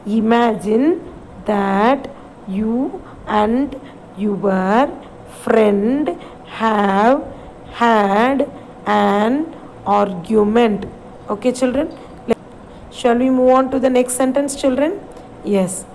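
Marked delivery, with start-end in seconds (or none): 0.1-10.9 slow, word by word read-along. Shown as a demonstration. Teacher reads the line with grade five, slow and word by word. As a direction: lead the class through the line slowly. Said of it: "Shall we read this line?".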